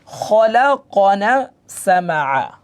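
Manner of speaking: very slow, drawn-out syllables; measured Quran-class sounding-out; joined-up spelling. Shown as a demonstration. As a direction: blend the letters into a slow recitation. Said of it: "সব মিলে কি হয়".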